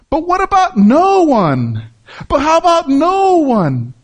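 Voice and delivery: high, slow laughing "But what about no one?"; mock question pitched high with a laugh; incredulous. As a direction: ask it high, half laughing at the idea. Preacher mock-asks, laughing.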